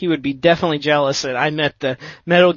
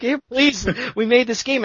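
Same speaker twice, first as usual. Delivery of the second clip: higher-pitched, mildly imitated plea; pleading; 7 semitones higher than usual. Developers pushing flyers on passers-by, mock pleading. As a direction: lightly act out begging someone to look. Retelling developers begging for attention.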